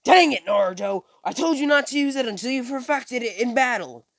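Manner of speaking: teacher shouting at a reckless pupil; scolding shout. Retold or imitated. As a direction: yell at the careless student. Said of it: "As Kakashi says".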